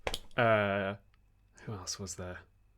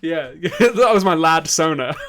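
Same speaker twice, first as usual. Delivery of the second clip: laughing; 6 semitones higher, 13 dB louder than usual. Real, not acted. Laughing over old nickname.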